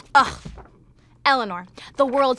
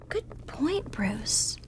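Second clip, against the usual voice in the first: breathy whispered aside; whispering; 7 dB quieter, much slower than usual. Acted. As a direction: whisper it to yourself. Aside after Bruce's line.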